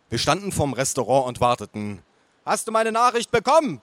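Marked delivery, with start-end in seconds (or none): 2.4-3.8 bellowing aunt. A narrator's reading. Aunt checking on her message, bellowing. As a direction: holler it like an overbearing aunt. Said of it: "brüllte meine Tante".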